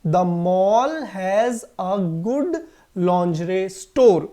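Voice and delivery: very slow, word by word; slow model sentence. Shown as a demonstration. Teacher reads the example sentence to the class very slowly. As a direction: dictate it slowly. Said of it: "correct pronunciation क्या हुआ?".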